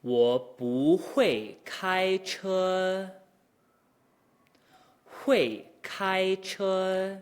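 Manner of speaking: very slow, exaggerated Mandarin tones; tone demo. Shown as a demonstration. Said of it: "first tone at the top".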